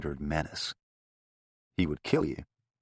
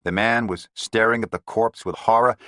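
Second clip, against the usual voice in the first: plain reading voice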